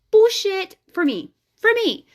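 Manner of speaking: loud, high, drawn-out dismissal; emphatic outburst. Real, not acted. Brushing off people who say she overthinks, loud and high. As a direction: slam it down like nonsense. Rejecting others' manifesting advice.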